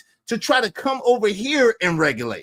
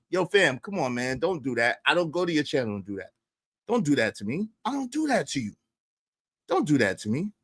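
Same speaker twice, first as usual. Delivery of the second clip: softer and lower, a reasoned plea; calm request; 6 semitones lower, 6 dB quieter than usual. Retold or imitated. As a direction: speak calmly, like a respectful friend.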